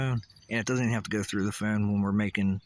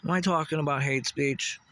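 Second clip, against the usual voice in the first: usual speaking voice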